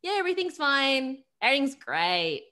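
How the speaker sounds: high, loud mock cheerfulness; imitating a glossed-over surface answer; fake-cheery brush-off. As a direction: chirp it too brightly to be believed. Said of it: "the surface response".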